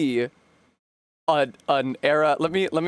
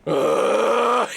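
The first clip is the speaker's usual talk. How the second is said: disgusted ugh; 9 semitones higher, 5 dB louder than usual. Retold or imitated. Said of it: "I went".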